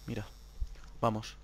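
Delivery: near-whisper, very soft; hushed. Real, not acted. Sneaking gamer hushing himself, very soft. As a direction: hush yourself while sneaking.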